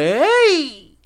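high, loud drawn-out "hey"; excited greeting. Retold or imitated. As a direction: call out a long, gleeful hey. Happy greeting retold in story.

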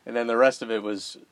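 normal talking voice

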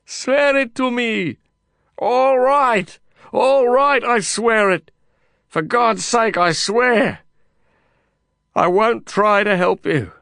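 high, loud giving-in; reluctant shouted oath. A narrator's reading. Lin swears to Kasim over the noise, loud reluctant oath. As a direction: give in out loud, against your will.

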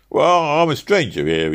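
high, loud flustered protest; flustered cabbie finding his words; struggling cabman. Acted. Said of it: "he managed to articulate".

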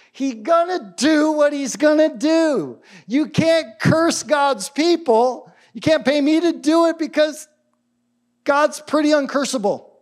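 high-pitched acted protest; Balaam. Prophet refusing the king's pay to curse, high emphatic protest. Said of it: "what does he end up saying?".

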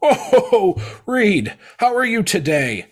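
Santa Claus. Retold or imitated. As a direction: boom it out like a cheery Santa greeting an old friend. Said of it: "he's like".

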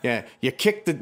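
normal talking voice

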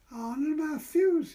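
put-on high, slow sing-song child voice; teasing child. A narrator's reading. Boy teasing Patricia with her sweetheart's name. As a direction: taunt them with the name.

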